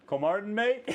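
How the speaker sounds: high imitated Australian accent. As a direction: imitate Australian friend asking, pitched high. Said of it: "his Australian accent just goes".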